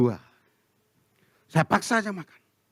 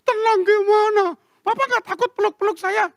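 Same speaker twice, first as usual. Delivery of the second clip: very high imitation of a crying wife; hammed-up distraught whine; mock tearful wail. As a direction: wail the protest through fake tears. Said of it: "Jangan nangis".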